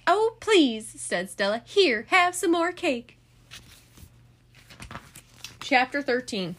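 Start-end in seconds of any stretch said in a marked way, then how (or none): 0.1-3.2 prim hostess Stella. A narrator's reading. Stella brushing off Frank.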